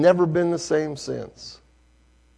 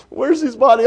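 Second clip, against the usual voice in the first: anxious question; 13 semitones higher, 11 dB louder than usual. Acted. Father asks about body.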